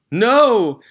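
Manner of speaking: high, loud shocked cry; horrified cry. A narrator's reading. Trunchbull crying out at her brother's name. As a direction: cry out in horror. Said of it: "she cried".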